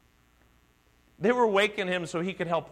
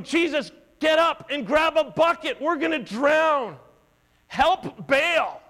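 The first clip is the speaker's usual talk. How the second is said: much higher, louder urgent yell; frantic shout; 9 semitones higher, 6 dB louder than usual. Retold or imitated. Disciples shouting at Jesus to grab a bucket, loud and high. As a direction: yell for help bailing over the storm. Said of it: "maybe they're going".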